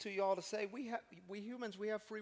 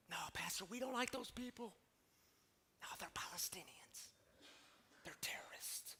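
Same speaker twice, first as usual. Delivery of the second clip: softer, half-whispered imagined congregant; mock hushed reply; 11 dB quieter than usual. Imagined churchgoer answering the pastor, hushed. Congregants voiced, quiet retort.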